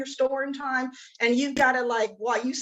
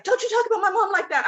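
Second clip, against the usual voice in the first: higher, quick protest; mock indignant defence; 9 semitones higher, 4 dB louder, faster than usual. Husband defending his mom to wife, mock indignant. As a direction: imitate a defensive son, fast and raised in pitch.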